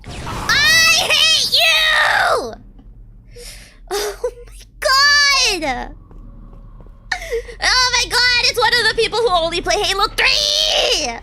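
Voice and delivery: high, loud screamed outburst with drawn-out words; raging. Real, not acted. Gamer raging at opponents in Halo, furious screaming. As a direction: scream it in real rage. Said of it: "I'm raging so bad".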